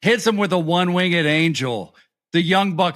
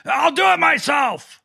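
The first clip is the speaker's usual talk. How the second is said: imitating Moxley yelling; 9 semitones higher than usual. Retelling Moxley yelling at Will, mock shout. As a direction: bark it like a furious wrestler. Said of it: "yelled at Will and said".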